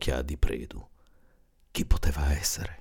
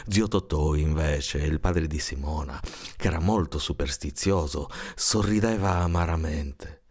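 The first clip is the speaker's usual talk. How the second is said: ordinary delivery, nothing marked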